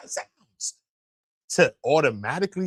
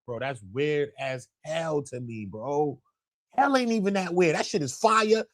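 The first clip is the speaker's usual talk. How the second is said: ordinary delivery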